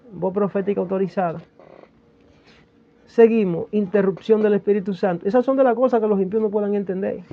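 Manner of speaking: ordinary talk, nothing marked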